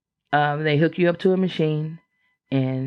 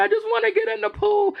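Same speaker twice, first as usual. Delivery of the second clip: much higher, fast tearful whine; whiny crying plea; faster than usual. Retold or imitated. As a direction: whine it like a kid who wants to swim. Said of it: "Oh, I cried every day".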